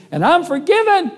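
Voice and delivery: very high, loud exultant cry; acted-out boy proclaiming his freedom; triumphant shout. Boy triumphantly answers the bully.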